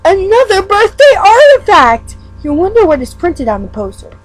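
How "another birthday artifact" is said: much higher and louder, a thrilled cry; excited announcement. Acted. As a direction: announce the find like a big surprise. Excited reader announces the artifact.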